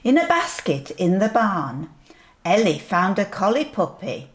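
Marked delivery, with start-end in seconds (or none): none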